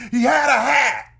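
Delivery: high, loud joke punchline; indignant complaint. Acted. Joke punchline, woman complains to God.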